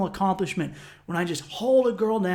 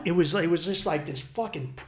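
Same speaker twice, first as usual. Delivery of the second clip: ordinary talk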